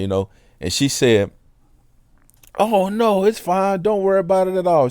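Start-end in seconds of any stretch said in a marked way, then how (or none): 2.6-4.9 much higher, imitating a sweet lady brushing it off; lady's cheerful reply. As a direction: mimic her light, breezy "no problem" voice, quicker at the end.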